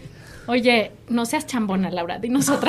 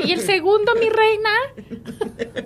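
high, drawn-out, syrupy "mi reina"; sugary señora voice; 9 semitones higher, much slower than usual. Retold or imitated. Old lady teasing a new mother about baby number two, high and drawn-out. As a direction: coo it like a nosy old aunt. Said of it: "y la señora".

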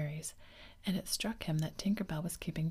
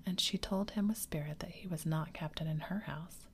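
ordinary delivery, nothing marked

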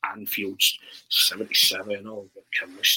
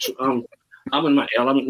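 usual speaking voice

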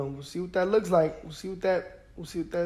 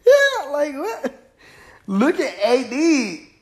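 real laugh, much higher and louder; 7 semitones higher, 5 dB louder, slower than usual. Real, not acted. Fan laughing off talk of bad trades, breaking into laughter. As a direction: laugh out the words in disbelief. Laughs at his own point.

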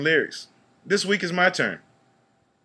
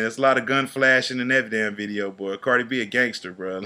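ordinary delivery

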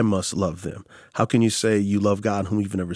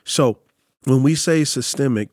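ordinary talk, nothing marked

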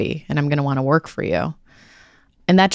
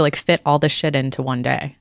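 ordinary talk

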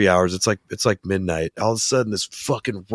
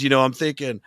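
ordinary delivery

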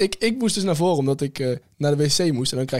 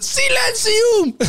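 high, loud, long-drawn mock yell; shouted imitation; 6 dB louder, much slower than usual. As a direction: imitate a chairwoman screeching for order. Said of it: "begon ze echt keihard te schreeuwen".

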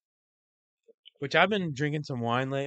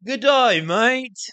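high, loud hammed-up Aussie greeting; a jokey Australian "good day, mate"; hammed-up Aussie accent, high and loud; mock Australian accent; 11 semitones higher, 8 dB louder, much slower than usual. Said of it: "That was English".

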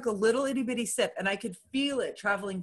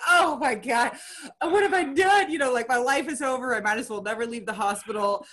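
mock Southern drama queen, pitched up and breaking into a laugh; 4 semitones higher than usual. Retelling her panic after surgery as a mock drama queen. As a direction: ham up the despair like a drama queen. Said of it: "drama queen of the South".

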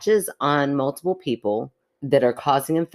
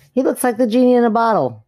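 loud, high and thrilled; excited; 9 semitones higher, 7 dB louder than usual. Real, not acted. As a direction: burst out excited. Excited reaction to a picture.

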